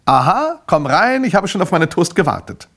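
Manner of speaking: high, loud, hearty welcome; eager customer. Acted. Man awaiting his toast at the door, high eager welcome. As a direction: usher someone in as if long awaited.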